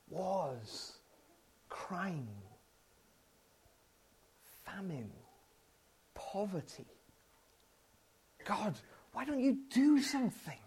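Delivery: soft, heavy lament to God; quiet despair. Retold or imitated. Despairing prayer over world news.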